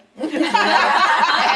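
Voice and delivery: loud high burst of laughter; bright real laugh. Real, not acted. Laughing at her own story.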